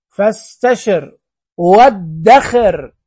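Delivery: slow, فاستشر run together with no glottal stop, festeʃir; joined hamza. Shown as a demonstration. Teacher to pupils, showing the joined hamza slowly. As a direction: glide straight through the alif in فاستشر and وادخر. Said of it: "في الوصل فاستشر".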